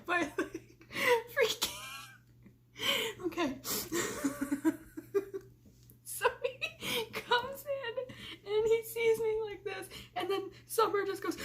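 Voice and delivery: high, giggly retelling through laughs; can't stop laughing telling the prank; laughing while telling. Real, not acted. Vlogger to viewers, laughing helplessly.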